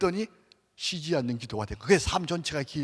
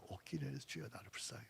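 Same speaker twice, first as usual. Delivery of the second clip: fast breathless whisper of the Kyrie; rushed hushed whisper; whispered prayer; 2 semitones lower, 22 dB quieter, much faster than usual. Shown as a demonstration. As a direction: whisper the prayer quickly to yourself. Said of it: "해보세요".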